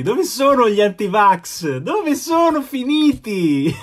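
high, drawn-out mocking call; mock taunting. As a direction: taunt the missing anti-vaxxers like a singsong tease. Mocking absent anti-vaxxers, high voice. (richiamo canzonatorio, acuto e strascicato)

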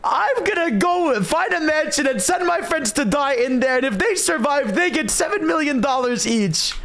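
high, hyped YouTube host voice; big energetic challenge announcement; Mister Beast intro. Acted. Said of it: "who wants to do the Mister Beast intro".

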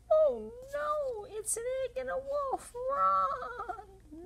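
high, loud mock panic at the wolf; mock fright. Reader acting scared of the wolf, high playful panic. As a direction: squeal like you've spotted a wolf.

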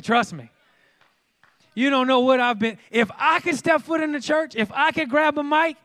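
loud, high preacher's cry; preaching at full force. Acted. Pastor telling the church he got in, full-force preaching. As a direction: pour it out to the congregation.

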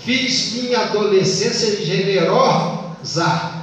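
"Fiz minha adolescência generosa" measured slowly by sounds, pausing after the stressed RO; metrical scansion. Shown as a demonstration. Teacher counting a verse's poetic syllables, slow metrical scansion. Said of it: "quando você conta em poesia, sílabas fônicas".

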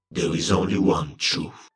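low Phyrexian chant-like murmur; eerie murmur. A narrator's reading. Phyrexian ranks on the battlefield repeating their creed, eerie murmur. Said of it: "Phyrexian murmured".

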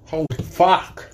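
reeling from the chili heat; mouth burning from spicy food. Real, not acted. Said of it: "And I'm burning".

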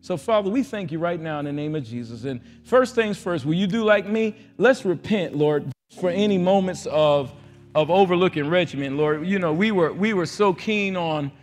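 loud, rising prayer that fades on the repeats; fervent prayer. Acted. Preacher leads church in loud repentance prayer, fading at the end.

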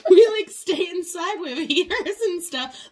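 giggling through the words, high and loud; amused giggly reply; laughing. Retold or imitated. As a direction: laugh while you explain. She giggles through her reply.

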